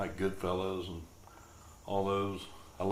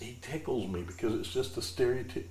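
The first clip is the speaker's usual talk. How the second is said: usual speaking voice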